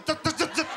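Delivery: sputtering starter noise zet zet. Shown as a demonstration. Joking, mimics the scooter starter turning right. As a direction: imitate a struggling starter motor. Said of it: "suara starternya aku".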